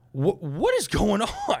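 slow, high-pitched incredulous question; baffled disbelief. Retold or imitated. Comic re-enacting staring at the angry stranger, baffled. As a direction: draw it out in mock bewilderment. Retold stunned reaction, drawn out.

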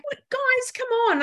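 high, light coaxing call to the dogs; high, playful coaxing to the pets. Retold or imitated. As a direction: chivvy the dogs along in a sing-song voice.

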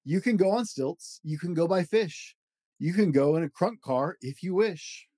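lively read-aloud of a rhyming picture book; storybook voice, playful and rhythmic; reading to children. Acted. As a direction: bounce through the rhymes for a child at bedtime. Reads Dr. Seuss book aloud.

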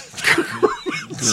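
short unvoiced laugh; breathy laugh. Real, not acted. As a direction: laughs at own slip. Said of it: "I was in my Polish accent".

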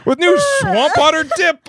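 ad announcer. Pretend. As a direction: sell the dip like an ad. Mock ad continues.

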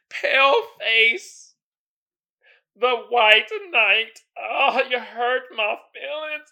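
slow, high mock wail; fake crying. Mock-crying over the old high school insults, slow and high. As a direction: ham up a weepy whine that won't come. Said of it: "I'm trying to cry, but I can't".

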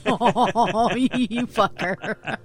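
slow words through laughter; laughing. Real, not acted. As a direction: laugh while saying it. Laughing at a beaten guess.

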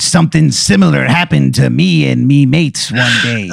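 pirate sea captain spinning a yarn; Captain Crunch. Acted. Sea captain watching the hail recalls his mates, pirate voice. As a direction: reminisce like an old sea dog. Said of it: "he goes".

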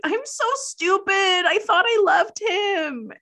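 very high, whiny lament; hammed-up self-reproach; mock wailing regret. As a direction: wail it melodramatically. Acts out Melissa's self-reproach.

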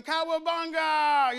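playful mock yell of kids jumping in. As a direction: yell it while leaping off the board. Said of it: "jumping off".

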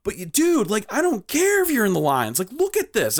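high-pitched retold indignation; exasperated arguing. Arguing with driver over parking lines, exasperated. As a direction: argue back high, with exasperation.

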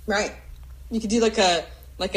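ordinary talk

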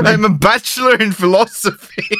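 laughing mock German villain; German accent. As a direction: parody the hitman in German accent. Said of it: "parodying Kaufman".

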